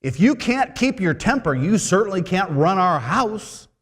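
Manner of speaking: high, loud indignant bride; angry bride. Retold or imitated. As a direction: act out a bride angrily calling off the wedding. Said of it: "His bride turns to him and says".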